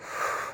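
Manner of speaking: long tired exhale; weary sigh. Real, not acted. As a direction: let out a drained sigh. Exhausted on steep climb.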